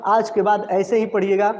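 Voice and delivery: usual speaking voice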